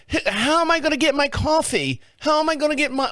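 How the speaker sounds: high, whiny British caricature; mock British accent. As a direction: put on an annoying, panicky British voice. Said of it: "I don't know why they have a British accent".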